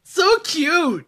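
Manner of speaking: high, loud squeal over something cute; delighted shout. Real, not acted. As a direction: gush out loud as if overwhelmed by cuteness. Reacting to cute koala impression.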